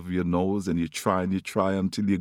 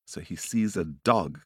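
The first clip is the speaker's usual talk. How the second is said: plain reading voice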